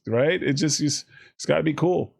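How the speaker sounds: ordinary talk, nothing marked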